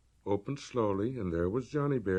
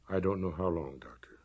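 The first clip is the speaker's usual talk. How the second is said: flat cold control, quieter; cold monotone; 6 semitones lower, 5 dB quieter than usual. A narrator's reading. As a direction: hold every feeling down under control. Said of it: "The monotone again".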